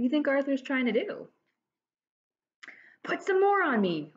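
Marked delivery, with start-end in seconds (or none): 3.0-4.1 a hint of a pleading child; demanding kid voice, a bit higher; lightly voiced boy asking for more; whiny little brother.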